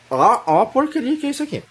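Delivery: exasperated. Real, not acted. Teacher exasperated by grammar rule.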